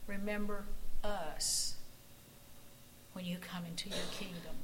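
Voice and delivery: quiet humble plea, sinking to a whisper; prayer. Acted. Pastor praying for the congregation, quiet and humble. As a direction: pray it softly, almost whispered.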